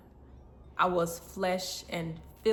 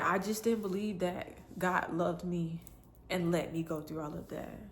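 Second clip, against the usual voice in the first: normal talking voice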